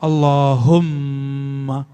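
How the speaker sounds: Allahumma drawn out slowly, the double m held; reading Allahumma. Shown as a demonstration. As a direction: read Allahumma slowly and hold the mm. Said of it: "Coba baca".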